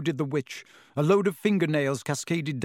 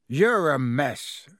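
slow, louder gruff scolding; a big man grumbling at a sooty boy; gruff Hagrid; 4 dB louder, slower than usual. A narrator's reading. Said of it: "said Hagrid gruffly".

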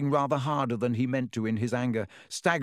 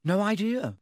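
fibbing; 9 semitones higher than usual. A narrator's reading. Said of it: "Harry lied".

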